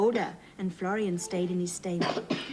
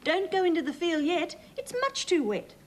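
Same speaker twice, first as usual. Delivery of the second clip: high, raised call to the tractor; farmer's call; 10 semitones higher than usual. A narrator's reading. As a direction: call out to stop him. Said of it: "shouted Farmer Klaus".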